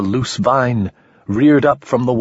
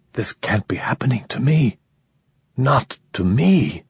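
stunned whisper; 2 semitones higher than usual. Acted. Dying man in disbelief.

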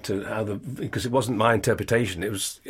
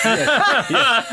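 laughing; 13 semitones higher, 6 dB louder than usual. Real, not acted. Laughing about Facebook post.

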